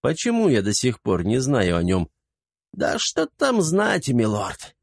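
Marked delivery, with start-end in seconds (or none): 2.7-4.8 put-on high, slow shifty old man; old servant voice. A narrator's reading. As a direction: evasive old servant, eyes darting. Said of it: "бегая глазами, ответил старик".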